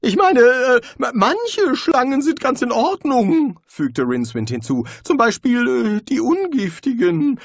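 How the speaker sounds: frightened wizard blurting out a secret; nervous wizard. A narrator's reading. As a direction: blurt it out, anxious and high. Rincewind voiced by narrator.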